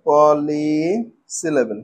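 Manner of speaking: "পলিসিলেবেল" slow and low, "পলি" held long; broken into syllables. Shown as a demonstration. Teacher introducing the last type, "পলিসিলেবেল" slowly drawn out. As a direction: stretch out the first part of "পলিসিলেবেল". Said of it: "ছোট ছোট টুকরো করে ভাঙবো".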